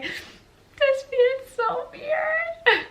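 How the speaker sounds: high, slow, whiny wonder; drawn-out squeal of disbelief; weirded out. Retold or imitated. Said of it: "And I was like".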